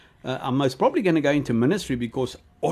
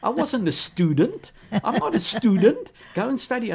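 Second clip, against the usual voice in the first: higher, stunned disbelief at being told to study; incredulous protest; 8 semitones higher than usual. Retold or imitated. Retelling refusing Bonnke's advice, higher with incredulous protest. Retells his own shocked reaction.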